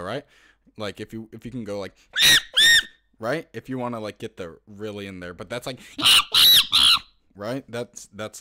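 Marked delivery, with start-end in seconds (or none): 2.1-2.9 very high, loud screeching shriek; high monkey screech. Shown as a demonstration. Showing viewers the high pitched monkey screech. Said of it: "however high you can go". 5.8-7.0 very high, loud strained shriek; weird extreme monkey screech. Shown as a demonstration. Showing viewers the weird extreme screech. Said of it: "the weird one".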